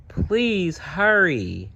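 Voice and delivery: put-on high, slow little-boy plea; impatient child. A narrator's reading. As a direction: drag out a child's whining request. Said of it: "said Franklin".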